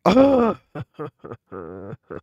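high, loud burst of laughter trailing off. Real, not acted. Player laughing during the game, loud laugh. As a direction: burst out laughing, then let it tail away.

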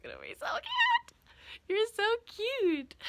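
high, cooing pet voice. Real, not acted. Cooing over her pony.

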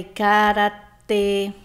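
karatê drawn out slowly, weight on final tê; slow, emphatic karatê with a closed ê; stressed final syllable. Shown as a demonstration. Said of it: "as últimas sílabas tônicas". (karatê bem devagar, com tê tônico e fechado)

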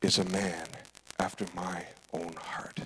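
slow, soft whisper; a hushed, reverent whisper; whispered voice of God. Acted. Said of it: "And God says".

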